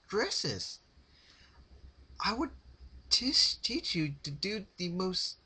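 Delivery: high, rasping, stammering plea; desperate pleading. A narrator's reading. Rarity begging Pinkie to spare her, high desperate rasp. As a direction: beg for your life through the stutter. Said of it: "desperation rasping her voice".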